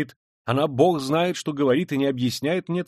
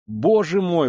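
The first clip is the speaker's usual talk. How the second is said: loud, high exclamation; exclaiming; 7 semitones higher, 8 dB louder, slower than usual. Acted. Mother dreading meeting her son, crying out loud. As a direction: burst out in dismay. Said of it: "воскликнула".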